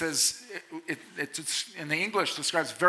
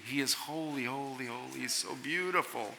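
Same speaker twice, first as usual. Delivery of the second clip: much softer, lower, reverent; hushed awe; 4 semitones lower, 9 dB quieter than usual. Real, not acted. Preacher to students, marvelling at God's holiness, hushed. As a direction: let the words sink in awe.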